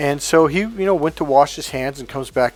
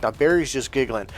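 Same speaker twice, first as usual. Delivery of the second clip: normal talking voice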